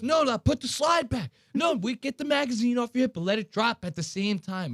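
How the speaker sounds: high, shouted rapid-fire commands; mock yelling orders. Instructor yelling orders at the kids, high mock shout. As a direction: bark order after order at the students. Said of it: "He's yelling".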